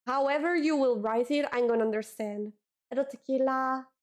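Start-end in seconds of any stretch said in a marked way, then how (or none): none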